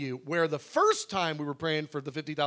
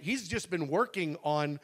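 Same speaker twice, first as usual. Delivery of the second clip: normal talking voice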